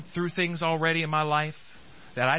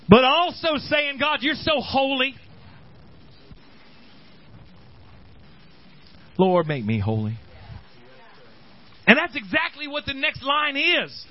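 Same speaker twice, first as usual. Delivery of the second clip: fervent preaching; 10 semitones higher than usual. Acted. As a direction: lift the church with your voice. Preacher rising into fervor.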